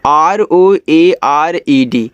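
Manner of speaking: letters spelled slowly; spelling aloud for students. Shown as a demonstration. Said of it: "इसको पढ़ते हैं rolled".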